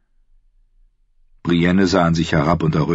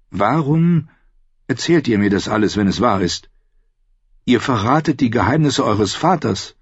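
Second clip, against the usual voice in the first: voiced higher as the young woman Brienne; shy woman knight voice, pitched up; Brienne's voice; 6 semitones higher than usual. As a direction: lift into Brienne's earnest higher voice.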